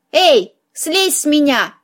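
usual speaking voice

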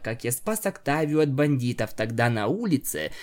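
usual speaking voice

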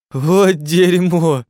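high, loud, drawn-out cry; despairing curse. A narrator's reading. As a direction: wail the curse slowly and loudly. Cursing in despair, no signal.

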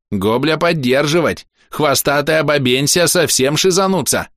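high goblin voice; high-pitched goblin jeer; indignant goblin. A narrator's reading. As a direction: chime in scornfully as a little goblin. Goblin chimes in with broken speech.

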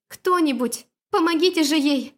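high, pleading shout; desperate call for help. A narrator's reading. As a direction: cry out for help.

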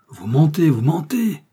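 loud, high cry of denial; outraged accusation. A narrator's reading. Brothers leaping up at the bandit's claim, outraged. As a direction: hurl it back in outrage.

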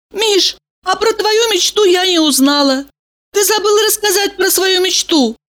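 high, playful woman's voice; Valeria's voice. A narrator's reading. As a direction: ask him, high and coaxing.

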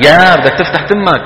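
usual speaking voice

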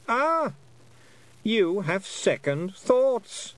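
high, slow queenly voice; knowing Queen. A narrator's reading. Queen finding the girl's weakness, high knowing voice. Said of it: "said the Queen".

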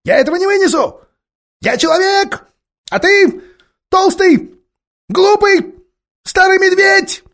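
very high, loud squealing insults; shrieking ape. Acted. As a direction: screech each insult at the bear. The ape rants at the bear.

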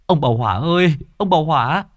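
high, loud urgent call, fast at the end. A narrator's reading. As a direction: shout for the troupe owner across the yard. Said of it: "Người kia gọi to".

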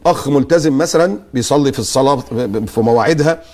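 normal talking voice

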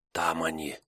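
hushed, soft warning; whisper. Acted. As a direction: whisper the sighting softly. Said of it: "прошептал Мекша".

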